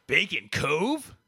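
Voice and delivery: Bacon Cove drawn out slowly, rising at the end; incredulous slow rise on Bacon Cove; disbelieving question. Shown as a demonstration. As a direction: ask Bacon Cove like you can't believe it. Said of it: "Bro, that's gotta be fake".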